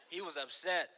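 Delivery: usual speaking voice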